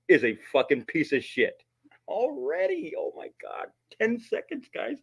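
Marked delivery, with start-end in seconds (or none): 2.1-5.0 amazed. Real, not acted. Amazed at fast receipt.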